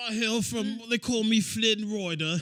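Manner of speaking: high, hammed-up British accent; terrible British accent. Host introducing himself as the movie hero, terrible British accent. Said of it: "his British accent was fucking terrible".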